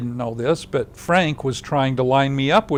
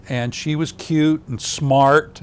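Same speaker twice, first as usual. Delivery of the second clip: usual speaking voice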